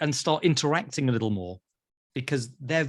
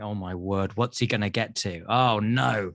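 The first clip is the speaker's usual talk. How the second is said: mock apprehension; 8 semitones lower than usual. Mimicking apprehensive listeners' inner reaction.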